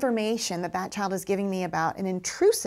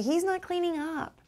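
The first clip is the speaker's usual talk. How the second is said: high whiny tattler, pitched up; tattling child voice; 8 semitones higher than usual. Retold or imitated. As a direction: imitate a child tattling to the teacher. Teacher voicing tattling child.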